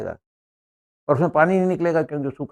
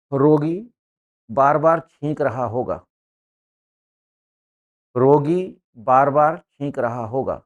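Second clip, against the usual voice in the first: slow dictation; slower than usual. Shown as a demonstration. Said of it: "dictating the sentence".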